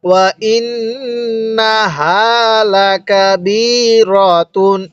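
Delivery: ওয়া ইন্নাহা slowly, the doubled ন hummed through the nose; with ghunnah. Shown as a demonstration. Teacher demonstrating ghunnah to students, slow nasal recitation. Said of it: "বলুন".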